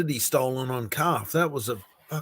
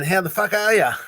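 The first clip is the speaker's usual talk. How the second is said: a hint of Australian, a little louder; Australian greeting; 5 semitones higher, 3 dB louder than usual. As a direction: lightly toss off an Aussie "How the fuck are ya?". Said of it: "That's the Australian way".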